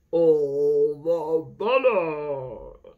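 put-on slow, loud old groundhog voice; wise old groundhog. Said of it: "said the groundhog".

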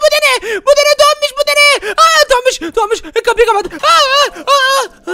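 high, loud frightened yelps; terrified cries. Acted. Seeing something behind him, terrified high cries. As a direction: shriek at the thing behind you. Sees scary picture.

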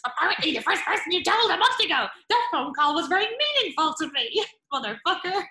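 high, loud, sarcastic comeback; mock indignant reply. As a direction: throw the lie back at him, hammed-up. Acts out sarcastic reply to caught liar.

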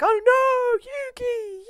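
very high, loud falsetto shriek; mock alarmed cry. Toin seeing Yuki fall, in a high mock shriek. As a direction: cry out in hammed-up panic.

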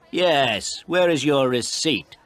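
high, loud, pointed question; forceful, needling demand; taunting challenge. Acted. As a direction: press the point triumphantly. Photographer presses his challenge.

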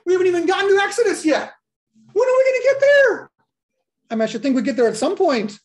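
high, loud, fast mock-impatient rant; exasperated outburst. Real, not acted. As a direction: blurt it out in comic frustration. Exasperated joke at slow progress.

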